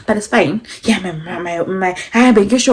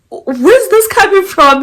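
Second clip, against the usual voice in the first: much higher, slow and loud; shocked disbelief; 12 semitones higher, 6 dB louder, slower than usual. Retold or imitated. Reacting to the guy's outburst, high shocked squeal. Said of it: "I was shocked".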